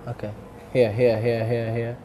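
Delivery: usual speaking voice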